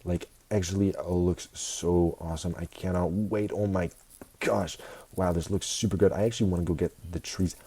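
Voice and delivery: normal talking voice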